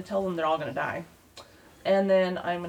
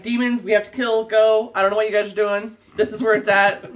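frantic shout; 2 semitones higher, 10 dB louder than usual. Retold or imitated. Frantic nun rallying the church.

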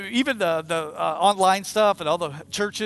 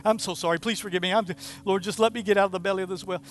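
desperate repentant babble; much faster than usual. Acted. Jonah begging God from the whale's belly, rattled-off desperate pleading. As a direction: repeat the apologies in an unbroken, muttered stream.

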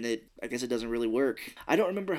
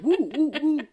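high, loud giggling woo; laughing whoops; 6 dB louder than usual. Retold or imitated. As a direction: whoop while dancing, laughing. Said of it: "He's like".

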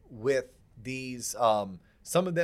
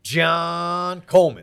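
ringmaster; 8 dB louder, much slower than usual. Acted. Drawn-out intro of co-host.

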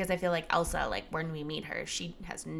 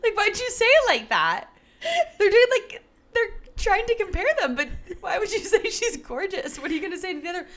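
high, loud, laughing protest; laughing disbelief; 12 semitones higher, 6 dB louder than usual. Real, not acted. Co-host objecting to the article's comparison, laughing.

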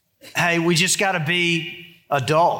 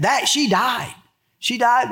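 put-on toddler wail; 6 semitones higher, slower than usual. Toddler wailing she died.

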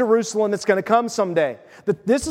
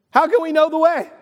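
higher, louder bewildered question; puzzled Thomas; 7 semitones higher, 3 dB louder than usual. Retold or imitated. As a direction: ask like a lost disciple. Thomas voiced asking Jesus.

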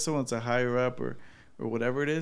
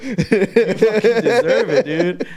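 laughing tease; 7 semitones higher, 13 dB louder, much slower than usual. Real, not acted. Teasing friend who yells.

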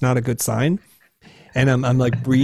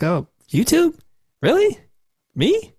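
very high squeaky disbelief, acted out; mock-incredulous, voice shooting way up; 14 semitones higher, slower than usual. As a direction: question each word as if it's absurd. Acts own disbelief at the suggestion.

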